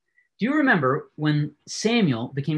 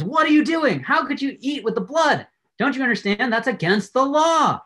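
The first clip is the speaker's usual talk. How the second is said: freaking out; 9 semitones higher than usual. Retold or imitated. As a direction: act out Saul's shocked indignation, pitched up. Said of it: "he freaks out".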